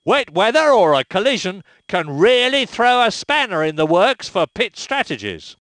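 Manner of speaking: ordinary talk, nothing marked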